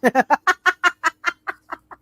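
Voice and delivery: high, staccato giggling laugh; a run of high, quick chuckles. Real, not acted. Game master reacting to a crotch-kick plan, laughing.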